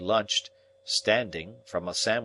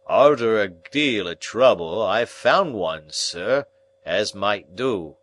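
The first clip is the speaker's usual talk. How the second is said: slow, loud, thick voice of a man with a cold; stuffed-up cold voice; 6 dB louder, slower than usual. Acted. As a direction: speak as if your nose is blocked. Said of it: "in the voice of a sufferer from a constitutional cold".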